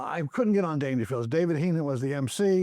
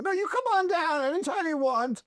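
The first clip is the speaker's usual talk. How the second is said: eager dad on the phone; 10 semitones higher than usual. Retold or imitated. Father voiced warmly to Eddie.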